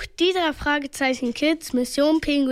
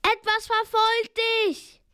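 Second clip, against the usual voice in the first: high-pitched urgent call; alarmed, high-voiced cry; yelled warning; 8 semitones higher than usual. A narrator's reading. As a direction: warn a friend in a high shout. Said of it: "brüllte er".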